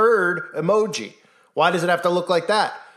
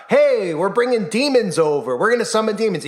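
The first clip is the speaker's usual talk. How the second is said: higher-pitched mock announcement; mock blunt announcement; 6 semitones higher, 4 dB louder than usual. Imagined officials telling everybody about demons, mock blunt announcement. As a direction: announce it brazenly, pitched up, as an imitation. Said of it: "told everybody flat out".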